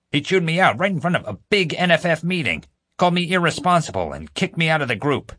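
high-pitched indignant complaint; aggrieved. A narrator's reading. Prisoner recounts his public dressing-down, aggrieved. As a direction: whine about the injustice.

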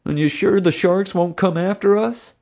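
high, anxious, whiny character voice; fearful Bubba. A narrator's reading. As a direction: whine for reassurance.

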